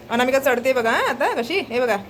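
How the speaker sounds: normal talking voice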